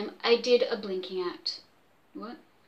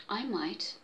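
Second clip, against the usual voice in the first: ordinary delivery, nothing marked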